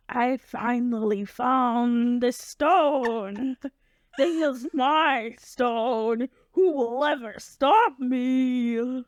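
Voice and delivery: slow, drawn-out villain gloat; slow theatrical villain menace. Pretend. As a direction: gloat slowly like a cartoon villain. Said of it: "I'll pretend like I'm a villain".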